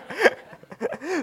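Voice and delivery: a sudden high laugh at his own joke; high burst of laughter. Real, not acted. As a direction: break into a quick laugh.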